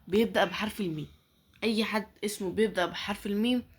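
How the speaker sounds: ordinary talk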